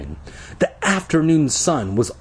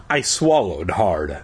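usual speaking voice

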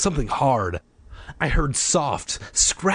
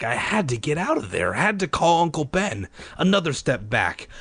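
ordinary delivery, nothing marked

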